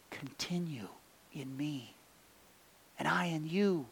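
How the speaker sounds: gentle, hushed invitation; soft tender plea. Acted. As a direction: draw listeners close, barely above a murmur. Tender plea voiced as Jesus.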